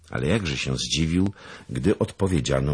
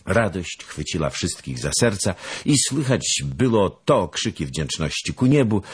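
ordinary delivery

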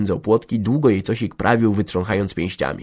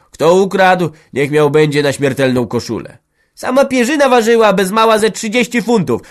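high, loud bickering peasant voices; quarrelling village women; 9 semitones higher, 7 dB louder than usual. Acted. Women squabbling over stolen bedding, high and loud. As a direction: bicker back and forth, sharp and loud.

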